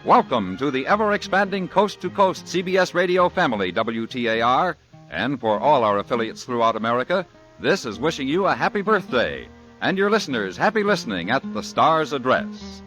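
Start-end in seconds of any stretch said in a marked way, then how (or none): none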